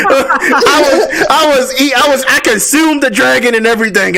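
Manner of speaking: excited, laughing high voice. Real, not acted. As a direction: talk through giggles.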